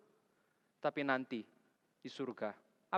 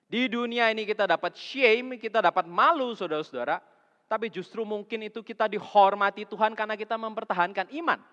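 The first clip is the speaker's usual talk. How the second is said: loud, high, driving sermon voice; full-force preaching; 7 semitones higher, 10 dB louder than usual. Acted. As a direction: preach it hard to the congregation. (khotbah berapi-api dengan suara keras)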